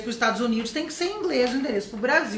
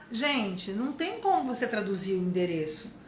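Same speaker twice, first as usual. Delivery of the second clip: ordinary talk, nothing marked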